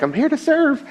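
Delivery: very high, chirpy volunteer voice; eager young girl. Retold or imitated. Young team member reporting to serve, eager imitation. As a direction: imitate a keen young helper. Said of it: "this young girl walks in".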